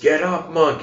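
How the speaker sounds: high, loud angry shout. Retold or imitated. Warlord shouts at the monk to get up, loud. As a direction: yell the order like a furious warlord. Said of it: "the warlord shouts".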